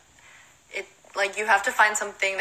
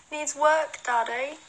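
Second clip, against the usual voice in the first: put-on a half-hearted British accent, higher; mock British accent; 7 semitones higher, slower than usual. Fan asks for a British accent, tentative British try. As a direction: try a quick British lilt. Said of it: "Talk in a British accent from now on".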